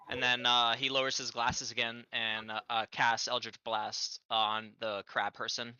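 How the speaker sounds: ordinary talk, nothing marked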